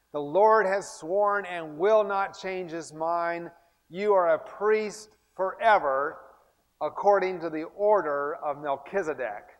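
loud, slow, emphatic verse reading; scripture recitation. Acted. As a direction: proclaim the verse slowly and forcefully.

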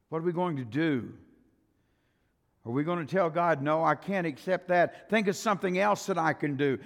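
loud, rising pulpit preaching. Acted. Preacher warning the church against defying God, in full preaching voice. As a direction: preach it hard to the congregation.

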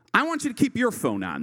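ordinary talk, nothing marked

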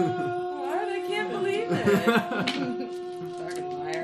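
high, loud, laughing disbelief; laughing outrage at the result; shocked disbelief. Real, not acted. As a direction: squeal at the lucky roll. Shock at a dice roll.

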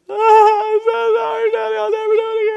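mock bawling child, very high and loud; hammed-up tearful wail. Daughter bursting into tears at dad over spelling, mock wailing. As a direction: wail through pretend tears, words running together.

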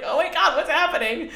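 high, giggly oh my God; laughing disbelief. Retold or imitated. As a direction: laugh through the words in disbelief. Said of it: "And I was like".

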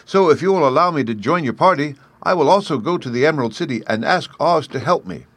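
high-pitched, earnest tin-man voice; hopeful Tin Man. A narrator's reading. Tin Man figure in story.

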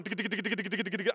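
rapid-fire pattering heartbeat noise; imitated racing heartbeat. Driver after a near accident, imitated racing heart. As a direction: rattle it off like a pounding heart. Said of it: "my heart is going".

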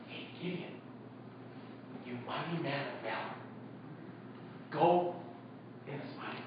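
hushed whisper to Gideon; soft, intimate whisper of encouragement; whispered call. Acted. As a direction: lean in and breathe it like a secret. God addressing Gideon.

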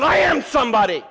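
loud, high-pitched furious outburst; angry cry out. Acted. Wife finally fed up with being belittled, furious shout. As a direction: cry it out, mad and at full voice. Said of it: "get so mad, you're gonna cry out".

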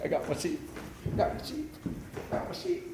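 very hushed, mostly whispered little chant; gleeful shepherd. Acted. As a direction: whisper it to yourself, delighted with your sheep.

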